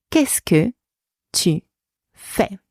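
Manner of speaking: spaced out, a pause after each chunk. Shown as a demonstration. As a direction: dictate it piece by piece. Teacher breaks down model phrase.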